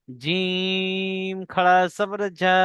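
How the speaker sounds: জা drawn out one alif long, very slow; slow recitation drill. Shown as a demonstration. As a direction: chant জা slowly with a long aa. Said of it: "পড়তে থাকবেন আমার সাথে সাথে".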